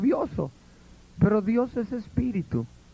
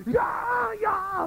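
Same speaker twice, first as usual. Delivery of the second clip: crowd answer. Retold or imitated. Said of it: "Y todos".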